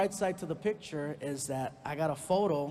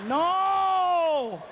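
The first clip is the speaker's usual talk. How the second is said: long, loud, high drawn-out "no"; exasperated wail; 13 semitones higher, 9 dB louder than usual. Retold or imitated. Stepdad hearing the son's wrong guess, hugely exasperated. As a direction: stretch it into a comic cry of despair.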